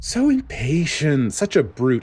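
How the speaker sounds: sugary scheming official. Acted. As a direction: purr an apology that is really a jab. Spandine scoffs at Sakazuki.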